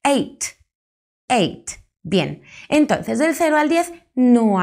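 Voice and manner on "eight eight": "eight" said as /eit/ with a plain T sound; teacher modelling "eight"; eight with a simple T. Shown as a demonstration. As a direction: sound out "eight" as /eit/, only a clean T at the end. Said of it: "Pues una simple T".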